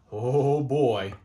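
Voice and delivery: usual speaking voice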